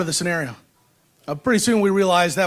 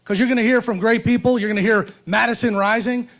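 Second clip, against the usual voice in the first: podium speech. Acted. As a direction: stir up the crowd from the podium. Rousing speech to rally crowd.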